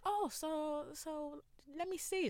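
imitating her mum; curious mum. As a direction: play the mum settling in to look. Mum voiced in the story.

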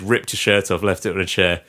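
ordinary delivery

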